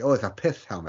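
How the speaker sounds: lisping on "pith" and "it's". Retold or imitated. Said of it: "a lisp, like".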